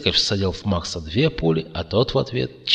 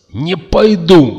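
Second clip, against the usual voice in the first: high, loud drawn-out wail; frantic yell; 9 semitones higher, 10 dB louder, slower than usual. A narrator's reading. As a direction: yell out a desperate refusal. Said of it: "истошно завопил он". (истошный вопль)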